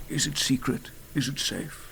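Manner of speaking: hushed mock wizard questioning; Gandalf. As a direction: play an old film wizard, half in jest. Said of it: "do my bad Gandalf impression".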